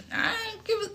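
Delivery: very high voice, trailing into an unfinished swear; high-pitched aside. Real, not acted. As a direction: slip it out way up high, cut short. Half-swallowed swear in an aside.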